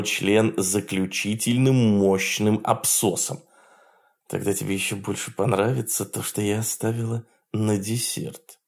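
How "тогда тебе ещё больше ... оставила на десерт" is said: soft, fast sultry purr; femme fatale. Acted. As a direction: tease him with a secret. Lakesha teasing a surprise.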